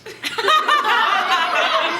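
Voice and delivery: a big high cackle; triumphant laugh; loud cackle. Acted. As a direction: burst out cackling at your own joke. Laughs at her own joke.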